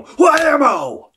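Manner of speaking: huge high-pitched shout; over-the-top accent. Acted. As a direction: blast it out like a wildly overdone drum accent. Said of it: "every time I say an accent, I go".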